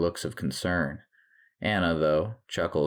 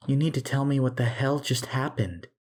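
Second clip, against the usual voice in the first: high, urgent demand; insistent confrontation; 8 semitones higher than usual. A narrator's reading. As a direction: press your husband for an explanation. Wife confronts doctor in hallway.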